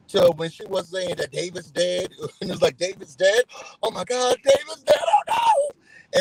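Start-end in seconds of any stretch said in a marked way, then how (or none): 2.8-6.2 hammed-up shocked cry, much higher and louder; mock panicked shouting. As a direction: escalate from disbelief into a mock wail. Retold panic over David being dead.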